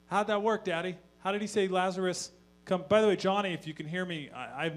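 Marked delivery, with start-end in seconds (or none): 0.1-2.8 put-on higher, louder little girl asking; a kid pestering her dad; child's voice. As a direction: play the curious daughter. Daughter asks, voiced higher.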